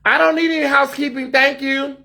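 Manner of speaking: bright high polite voice to housekeeping; high call-out voice. Real, not acted. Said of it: "Are y'all laughing at my voice?".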